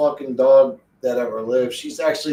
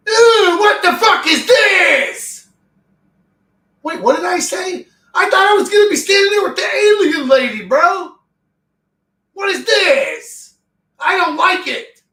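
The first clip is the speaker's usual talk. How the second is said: disgusted shout; 10 dB louder than usual. Real, not acted. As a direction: shriek it in horror and revulsion. Disgusted at game screen.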